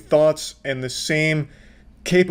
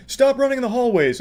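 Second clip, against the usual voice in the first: higher-pitched mock scolding; hall monitor; 8 semitones higher than usual. Commentator imitating a hall monitor chasing someone, mock scolding. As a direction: call it out like an officious hall monitor. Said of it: "just like a hall monitor".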